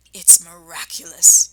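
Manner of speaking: gasping whisper after a gulp; breathless whisper. Acted. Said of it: "he whispered, fighting for breath".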